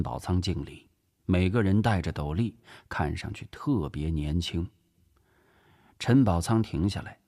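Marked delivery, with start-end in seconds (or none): none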